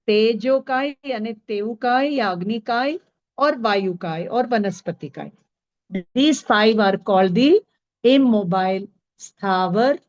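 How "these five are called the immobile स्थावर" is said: slow dictation. Shown as a demonstration. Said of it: "slow dictation to students".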